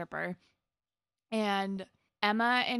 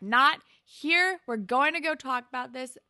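firm insistence; 4 semitones higher, 6 dB louder than usual. Retold or imitated. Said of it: "she's like".

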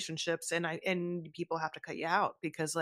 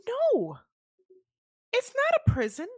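mock high voice. Host spoofing her prison complaint, in a very high put-on voice. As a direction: pitch it way up and stretch it out theatrically.

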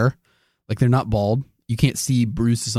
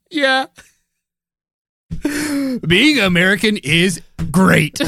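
sarcastic fake enthusiasm, pitched up; 9 semitones higher than usual. Sarcastic mock patriotic line.

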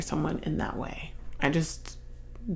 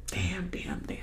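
whispered damn; 12 dB quieter than usual. Real, not acted. Emotional reaction to sad scene.

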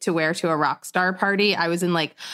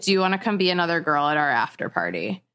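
offhand, unexcited invitation; casual; 3 semitones lower, faster than usual. Retold or imitated. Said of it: "just, like, casually".

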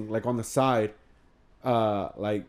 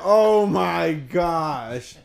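very slow, stretched, louder and higher; drawn-out dread; 5 semitones higher, 6 dB louder, much slower than usual. Retold or imitated. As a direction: let the words crawl out in disbelief. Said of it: "And I was like".

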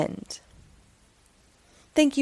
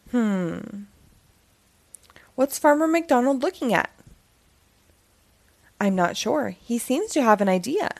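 lively read-aloud storytelling voice; story narration; 4 semitones higher than usual. A narrator's reading. Storyteller reading a Halloween book to children.

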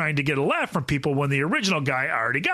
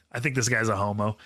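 ordinary delivery

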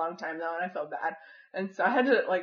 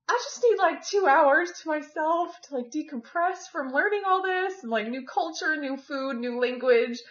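overwhelmed; 7 semitones higher than usual. Retold or imitated. Acting out her overwhelmed self.

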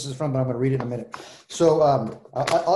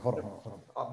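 much softer, almost off mic; hushed aside; 6 semitones lower, 11 dB quieter, much faster than usual. Real, not acted. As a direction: murmur it away from the mic. Answering someone at the door.

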